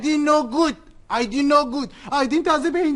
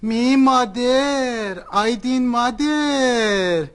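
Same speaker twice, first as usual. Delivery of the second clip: slow insistent; much slower than usual. Acted. Mother declaring slowly in broken speech.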